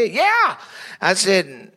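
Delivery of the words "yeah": high eager yeah; quick high kid yeah. Retold or imitated. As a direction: chirp yes like a boy. The kid agrees.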